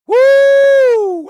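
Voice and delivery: very high, loud shrieking whoop; loud high mock whoop of disapproval. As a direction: let out a shrill whoop. Mimicking feeling women's stares.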